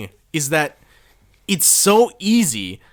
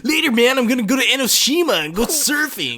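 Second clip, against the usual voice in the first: higher-pitched mock surfer dude; nineties dude; 6 semitones higher than usual. Expat heading off surfing, mock nineties dude voice. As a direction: play the dated surfer bro. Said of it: "straight out of the nineties".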